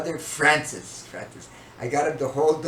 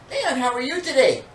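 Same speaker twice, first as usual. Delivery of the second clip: high-pitched, louder motherly greeting; mother's voice; 12 semitones higher, 5 dB louder than usual. Retold or imitated. Mother questioning her son at dinner, high mock-sweet voice. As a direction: imitate a mother checking up, pitched way up. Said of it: "she, first of all, to me".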